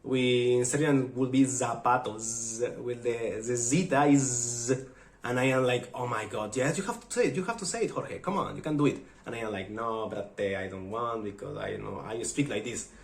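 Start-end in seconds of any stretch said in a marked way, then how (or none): none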